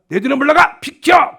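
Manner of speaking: commanding. Acted. The Lord orders Peter aside, shouting a command. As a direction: shout them out of the way. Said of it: "그런 거야, 주님이".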